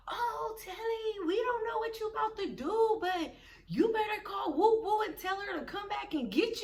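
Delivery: high, whiny mock sing-out; calling from passing car. Cousins drive by leaving Telly behind, mock calling out. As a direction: imitate cousins hollering a careless excuse.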